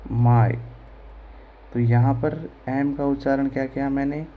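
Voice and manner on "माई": माई said louder, clearly stressed; teacher punching माई loud. Shown as a demonstration. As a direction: hit माई firmly for the class. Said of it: "तो इसको हम पढ़ेंगे".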